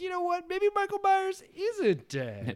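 a lightly hammed exec having a sudden idea; mock studio execs. Studio execs reviving the killer, in a high sly voice. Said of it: "They're like".

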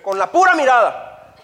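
a hint of a proud strict dad; boastful father. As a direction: brag about the stern look. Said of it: "Un papá me dijo".

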